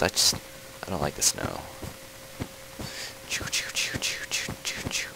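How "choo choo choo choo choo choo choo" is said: soft unvoiced choo-choo noises; whispered choo sounds. Player making noises while playing, whispered choos.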